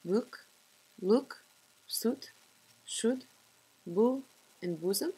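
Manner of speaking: Scottish accent demo. Shown as a demonstration. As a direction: say the vowels with flat lips, Scots style. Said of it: "For example".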